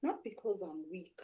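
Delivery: ordinary delivery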